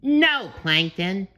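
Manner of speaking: high cartoon voice; SpongeBob correcting. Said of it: "SpongeBob gently corrected him".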